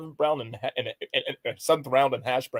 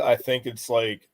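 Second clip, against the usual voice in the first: ordinary talk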